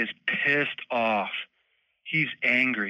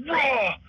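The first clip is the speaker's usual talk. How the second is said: short mock bear roar, pitched way higher; imitated bear roar; 12 semitones higher than usual. Telling the host how the shot bear cried out, a high mock roar. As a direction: yelp out the bear's cry the moment the arrow hits, higher. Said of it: "super loud".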